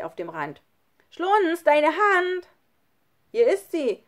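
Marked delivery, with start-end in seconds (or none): 1.1-2.5 high, loud shout for help; urgent call. A narrator's reading. Child calls up for help. 3.3-4.0 loud, higher answering shout; calling out. A narrator's reading. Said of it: "rief er".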